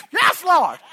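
high, loud burst of mock praise; imitated praise shout. Imitating Pentecostals praising after a sneeze, high shout. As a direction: imitate an over-eager worshipper shouting. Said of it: "they'll just shout".

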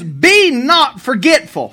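"Be not forgetful" read slow and emphatic; slow, weighty reading of "Be not forgetful"; reading the verse aloud slowly; stress on not. Shown as a demonstration. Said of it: "it says".

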